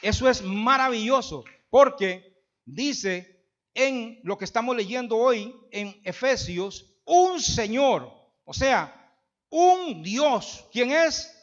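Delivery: jubilant, booming preacher; fervent preaching. Acted. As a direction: shout the wonder of it to the congregation. Preaching at full force, shouted.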